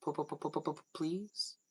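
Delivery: put-on childish pleading stutter, very soft; begging please. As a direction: beg with a stammering p-p-please. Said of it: "And then I'd be like".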